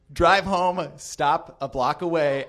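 usual speaking voice

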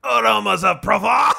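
dreamy French ad voice; hammy French accent, slow and lush; french chef. Acted. French ad parody read.

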